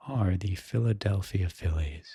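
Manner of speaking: ordinary delivery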